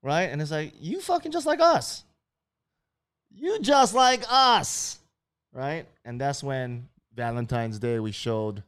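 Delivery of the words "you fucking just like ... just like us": high, loud, drawn out mock outrage; high mock shout. Locals shouting at Captain Cook, high mock outrage. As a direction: yell it up high, in disbelief.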